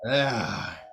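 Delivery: Southern drawl, held through the whole rant. Acted. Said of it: "is this man from Lancaster?".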